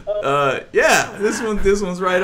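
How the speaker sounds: slowed, laughing through each word. Real, not acted. To the stream, laughing about this awkward interview. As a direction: chuckle out the punchline.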